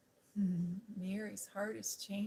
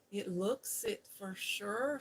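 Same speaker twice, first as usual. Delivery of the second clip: slow, louder broad Yorkshire maid's voice; Yorkshire brogue; 5 dB louder, slower than usual. Acted. Martha to Mary, in a broad Yorkshire brogue. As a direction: drawl it out in thick Yorkshire dialect. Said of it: "She's speaking in that old Yorkshire brogue".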